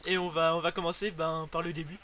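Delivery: ordinary talk, nothing marked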